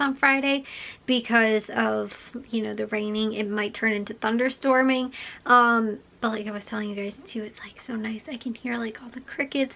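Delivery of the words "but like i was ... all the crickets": whispering. Real, not acted. Quiet night whisper.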